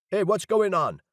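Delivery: guard challenging. A narrator's reading. Guard leader demanding what is going on. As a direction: challenge them like a wary guard.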